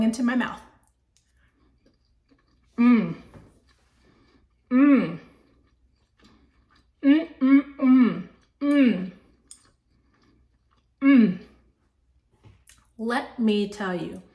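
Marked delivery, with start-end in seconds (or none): 2.8-11.4 loud savoring hums while chewing; appreciative mm. Real, not acted. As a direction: hum with pleasure at each bite. Tasting the chicken.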